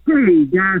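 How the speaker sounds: ordinary delivery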